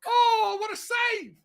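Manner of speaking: high, loud gasp of relief on the save; screaming in relief. Real, not acted. Fan still reeling from the save, shouting in relief. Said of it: "I'm screaming and shouting".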